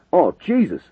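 loud startled cry; shouting. A narrator's reading. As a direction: shout in sudden fear. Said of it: "a voice shouted".

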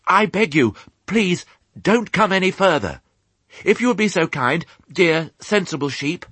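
high, urgent, courteous little piglet; polite pleading. A narrator's reading. Piglet on tailboard begs flock to stop, pleading. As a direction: beg them high and ever so politely. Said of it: "being so politely addressed".

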